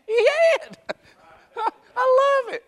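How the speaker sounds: high, laughing through tears. Real, not acted. Preacher delighting in answered prayer, laughing. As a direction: laugh with real joy at it.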